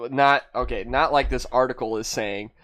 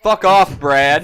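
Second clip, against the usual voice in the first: mock angry; 4 semitones higher, 10 dB louder, slower than usual. Real, not acted. Snapping back at a teasing friend.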